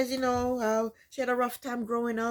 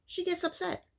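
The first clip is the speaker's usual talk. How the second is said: high, softer disbelieving repeat; indignant echo; 10 semitones higher, 4 dB quieter than usual. Real, not acted. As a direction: say it again in disbelief, quieter.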